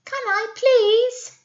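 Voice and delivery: very high pleading little voice; baby goat begging to go first; little billy goat. Acted. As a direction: beg like a small kid. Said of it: "said the little billy goat".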